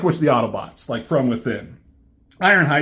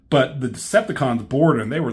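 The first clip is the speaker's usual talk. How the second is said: ordinary delivery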